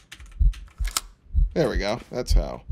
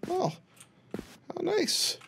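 squeaky mock delight, almost whispered; sarcastic high squeak; 12 dB quieter, much slower than usual. Real, not acted. Sarcastic remark on creepy decor.

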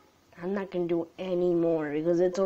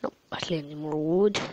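ordinary talk, nothing marked

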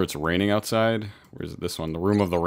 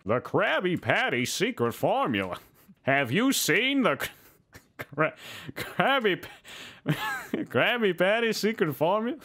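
higher, slow scheming squeak; cartoon villain; 12 semitones higher, slower than usual. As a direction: plot it like a tiny villain. Same line again, higher.

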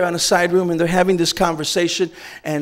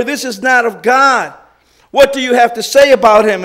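angry declaration; 5 semitones higher, 8 dB louder than usual. Retold or imitated. Pharisees to the healed man, enraged and loud. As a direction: condemn him with rage. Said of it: "Enraged, they declare".

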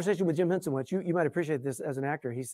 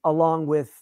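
normal talking voice

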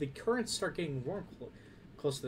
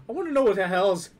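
loud, higher-pitched demand; exasperated outburst; 7 semitones higher, 15 dB louder than usual. Real, not acted. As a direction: burst out in loud frustration. Frustrated outburst during play.

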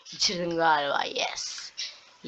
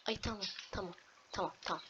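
much softer okay okay; hushed calming; 15 dB quieter, faster than usual. Real, not acted. Gamer soothing self after a scare, hushed. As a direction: breathe it out to calm down.

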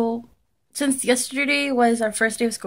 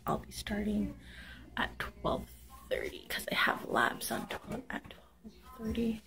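whispering; 13 dB quieter than usual. Real, not acted. Quiet morning talk.

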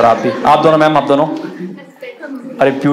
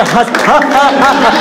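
higher fake ha ha; mock forced laugh; 8 semitones higher than usual. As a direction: act out a prompted laugh. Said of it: "वो इशारे करती है". (इशारे पर नकली हँसी)

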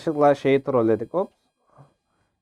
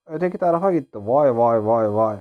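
usual speaking voice

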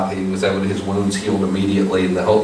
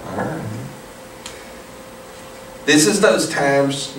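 ordinary talk

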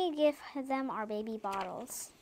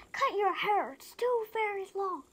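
higher, louder imitation of mom; mom's order imitated; 8 semitones higher, 4 dB louder than usual. Child retelling what mom says about hair, imitated insistent order. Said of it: "Mom says".

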